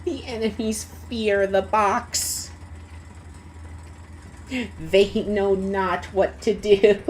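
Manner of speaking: low, slow, ominous proclamation; hammed-up grave storyteller, taking time; mock-epic narrator. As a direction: intone it like a solemn legend.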